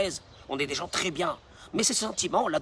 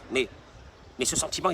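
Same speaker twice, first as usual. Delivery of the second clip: ordinary talk, nothing marked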